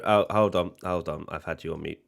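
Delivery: usual speaking voice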